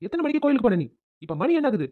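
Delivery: high, sharp demand; angry interrogation. Acted. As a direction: confront a latecomer crossly. Husband angrily questions late wife. (கோபத்தோடு விசாரணை)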